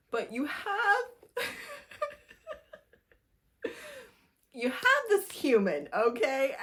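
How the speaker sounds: can barely get it out for laughing. Real, not acted. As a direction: giggle fit mid-sentence. Laughing at terrible book.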